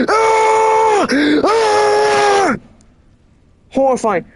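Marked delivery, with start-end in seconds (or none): 0.1-2.6 high shrill mock scream of terror; imitated screams. Imitating players dying next door, mock screaming. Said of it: "Just, like, the screams".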